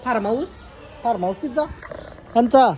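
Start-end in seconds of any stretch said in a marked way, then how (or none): 2.3-2.7 louder repeat. Real, not acted. Repeats question louder to child.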